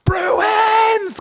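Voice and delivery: very high, long, loud holler; wild high team-name yell; screamed reply. Retold or imitated. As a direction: scream it back at full pitch. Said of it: "I just scream back".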